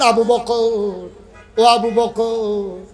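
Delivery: long drawn-out name call; slow, sustained call. Acted. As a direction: stretch the name as if hailing a companion. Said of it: "ডাক দিয়া বললেন".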